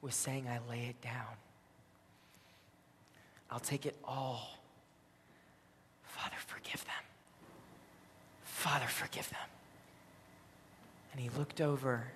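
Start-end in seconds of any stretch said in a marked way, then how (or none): 0.5-9.5 soft last-breath whisper; whispered prayer. Retold or imitated. Jesus prays with his last breath, whispering. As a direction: let each phrase out as a dying breath. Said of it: "listen to his whispered".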